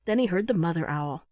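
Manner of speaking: usual speaking voice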